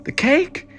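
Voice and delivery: high, loud cry of alarm; shout. Acted. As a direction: yelp as the cake flies off. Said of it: "Evelyn shouted".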